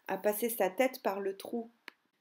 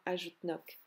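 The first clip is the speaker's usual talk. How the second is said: ordinary delivery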